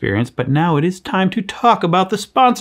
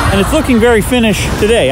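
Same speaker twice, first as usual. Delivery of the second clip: laughing; 7 semitones higher, 3 dB louder than usual. Real, not acted. Pun said through laughter.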